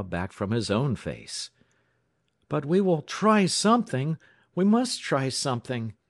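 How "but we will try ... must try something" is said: raised, higher-pitched desperate plea; high, urgent outburst; urgent cry. A narrator's reading. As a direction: cry it out, insisting on action. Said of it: "he cried".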